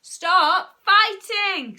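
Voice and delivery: high, loud, drawn-out order; scream. A narrator's reading. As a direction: scream at the children, stretching each word. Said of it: "screamed Mum".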